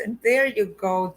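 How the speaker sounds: ordinary delivery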